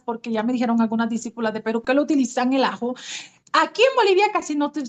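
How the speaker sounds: ordinary delivery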